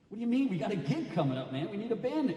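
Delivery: high-pitched, incredulous objection; bewildered protest. Retold or imitated. Poet arguing with his bandmate, incredulous.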